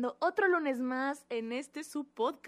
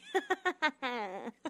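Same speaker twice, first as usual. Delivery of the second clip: evil laugh; 4 dB quieter than usual. Acted. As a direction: cackle like a cartoon villain. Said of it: "La, la risa malvada".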